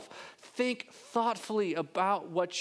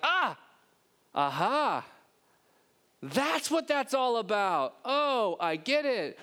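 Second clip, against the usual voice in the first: aha moment; 6 semitones higher, slower than usual. Retold or imitated. Believers' sudden faith realization.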